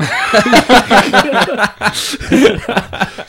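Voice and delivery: big high burst of laughter; loud laugh. Real, not acted. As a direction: cracks up loudly. Laughs at own joke.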